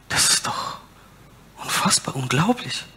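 awed whisper. Real, not acted. Awe at God's mercy.

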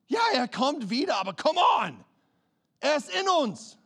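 high exhorting shout; preacher shout. Acted. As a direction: shout it out to the church.

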